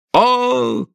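a high drawn-out "oh"; high-pitched cry. A narrator's reading. Woman crying out amid the struggle, high wail. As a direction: wail it out in one long high note.

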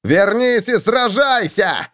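slow, high bellowing challenge; roar. A narrator's reading. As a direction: roar the dare slowly. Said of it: "проревел Роди".

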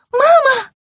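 very high, loud, frightened cry; crying out for mum. Acted. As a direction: run home wailing for mother. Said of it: "закричала ящерка".

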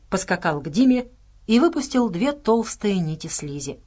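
plain reading voice

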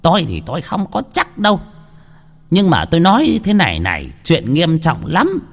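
a healer warning gravely; stern healer. A narrator's reading. Healer warns the wife, stern and grave. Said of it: "Ông thầy lang nghiêm mặt nói".

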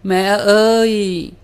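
very high, loud, slow wail; anguished wail. A narrator's reading. Bride keeps wailing for her mother, very high and loud. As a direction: scream out for mother in grief. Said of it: "Mình vẫn gào thảm thiết".

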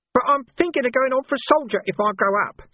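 a wheedling gutter lad taking offence; cheeky street urchin Nobby, aggrieved. Acted. Nobby's voice held.

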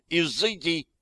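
high-pitched, malicious command; high exorcising cry; spiteful cry. A narrator's reading. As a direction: cast them out with spite. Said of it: "злобно выкрикнула Виктория".

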